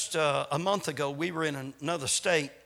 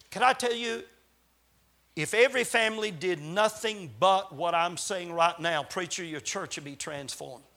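ordinary delivery

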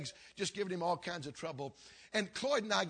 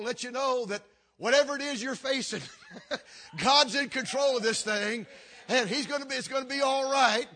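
laughing reassurance; 6 semitones higher, 8 dB louder than usual. Retold or imitated. Old friend's reassuring phone call.

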